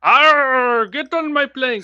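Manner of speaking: high-pitched pirate order; pirate captain. Pretend. Captain orders victim onto plank, high pirate voice. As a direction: growl an arr, then order them aboard. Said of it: "my pirate ship's name is".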